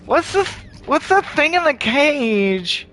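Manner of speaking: very high put-on questioner; squeaky, drawn-out curiosity; player character asking. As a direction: pitch it way up and stretch it. Voices player's question to guard.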